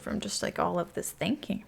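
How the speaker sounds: breathy worn-out whisper after catching her breath; exhausted whisper. Real, not acted. To viewers while placing a house, a tired whisper. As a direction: whisper it like you're drained from thinking. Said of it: "I'm, like, exhausted".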